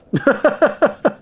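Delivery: short high laugh of disbelief; frustrated laugh. Real, not acted. Can't wield the weapon.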